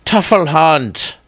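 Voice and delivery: slow, high mock German accent. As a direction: say Tuffle Hunt the German way, drawn out. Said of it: "I don't even do a good German accent".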